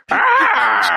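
a mock yelp of pain; mock cry. Cat joke gets a mock pained cry.

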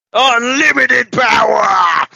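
slow, booming evil emperor shout; Palpatine shout. Retold or imitated. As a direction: roar it like a mad emperor.

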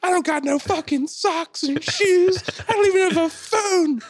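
sustained very high screamed rant; imitated screaming. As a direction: imitate a woman shrieking at the man leaving her. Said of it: "And she's screaming".

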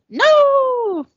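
sudden high shouted no; yell. Pretend. Boy yelling a warning at the killer, loud shout. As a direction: cry out a warning. Jordy yells at attacker.

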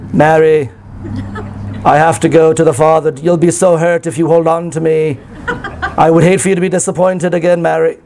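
mock British Jesus, no feeling at all; emotionless British. Shown as a demonstration. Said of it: "an emotionless British sort of like".

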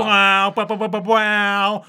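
goofy wow chant; silly clown. Pretend.

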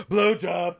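high, loud singsong announcement; mock promo voiceover. Host jokes about a next-week promo, high loud voiceover. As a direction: belt it out like a silly teaser. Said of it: "do the coming next week voiceover".

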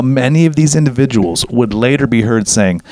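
ordinary delivery

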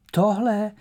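high, loud startled question; horrified disbelief. A narrator's reading. Myška is offered a toadstool to eat, horrified disbelief. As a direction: blurt it out, aghast. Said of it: "Vyděsil se Myška".

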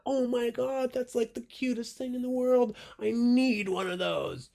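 very high-pitched mock-excited gush; gushing customer. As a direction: imitate a fan squealing over something cute. Acting out customer's delighted reaction.

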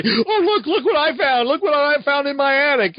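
high, gleeful mock show-off; mocking braggart. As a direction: gush like you found treasure.

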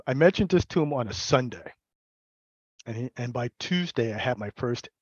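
usual speaking voice

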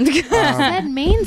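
"mansplaining" drawn out slowly as "mainsplaining"; slow mocking "mansplaining" with an added I; mimicked mispronunciation. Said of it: "You added an I".

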